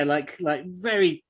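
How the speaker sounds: ordinary talk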